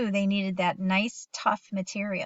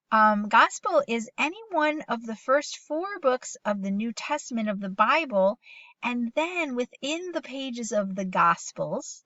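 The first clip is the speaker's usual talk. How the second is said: ordinary talk, nothing marked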